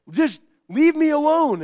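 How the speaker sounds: loud, high leave me alone; exasperated outburst. Retold or imitated. Parent wanting to be left alone, loud and exasperated. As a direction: throw it out in frustration. Selfish heart crying out.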